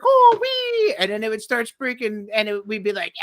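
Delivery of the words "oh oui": much higher, louder, surprised French lady; mock French operator. The French operator answers the prank call in a chirpy higher voice. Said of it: "it would be like".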